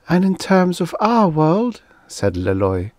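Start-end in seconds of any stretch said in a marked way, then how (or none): 0.1-1.8 high-pitched, plaintive female character; sad. A narrator's reading. She worries for her own world, sad. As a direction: ask it sorrowfully, high in the voice. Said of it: "spinning sadly over the ground".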